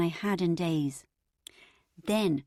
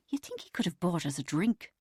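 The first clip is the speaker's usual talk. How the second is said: bitter. A narrator's reading. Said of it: "said Bridget bitterly".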